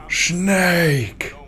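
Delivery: high calling out "Snake!"; Solidus calling Snake. Pretend. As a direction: yell "Snake!" like the Metal Gear villain. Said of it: "is that Solidus".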